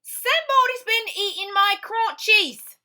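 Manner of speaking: high, loud indignant dad; Mister Blair. Acted. Mister Blair finding his cereal eaten, high and loud. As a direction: boom out the complaint. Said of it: "said Mister Blair".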